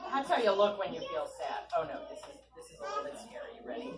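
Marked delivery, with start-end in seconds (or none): 1.8-3.9 whispered, higher mock-spooky warning; mock-scary whisper before showing a face; spooky hush. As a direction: hush them for something scary.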